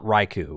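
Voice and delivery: Raikou said as RYE-koo; rejected pronunciation. Shown as a demonstration. Host rejecting the childhood version, Raikou as RYE-koo. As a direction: Raikou with a long "oo" ending. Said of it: "It's not Raikou".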